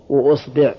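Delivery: "wa-usbi'", u opening and i after the b; damma, then kasra. Shown as a demonstration. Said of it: "ضم الهمزة". (وأصبع بضم الهمزة وكسر الباء)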